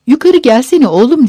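put-on high, loud call to a child; shouted call. A narrator's reading. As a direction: call out sharply for your son to come. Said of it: "diye bağırmıştı".

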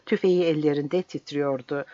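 ordinary delivery

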